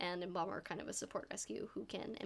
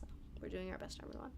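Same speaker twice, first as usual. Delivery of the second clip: ordinary delivery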